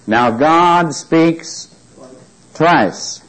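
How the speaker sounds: "Now, God speaks" slowly, pause, then "twice" with a falling stress; slow it right down to land "twice"; emphatic, drawn out. Shown as a demonstration. Preacher stressing repeated command.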